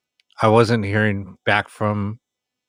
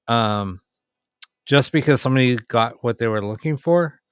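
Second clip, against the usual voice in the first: ordinary talk, nothing marked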